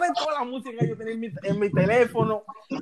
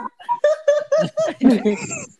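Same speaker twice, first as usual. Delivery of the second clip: high girlish mock giggle; 5 dB louder than usual. Girl's laugh voiced.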